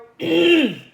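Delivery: loud chesty cough; hard cough to clear the throat. Real, not acted. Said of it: "I have a frog in my throat".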